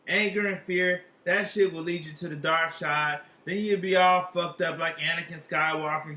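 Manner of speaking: normal talking voice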